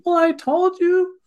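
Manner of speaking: high, whiny pity-party voice. Retold or imitated. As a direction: whine it like a sulking child. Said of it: "this little whiny voice".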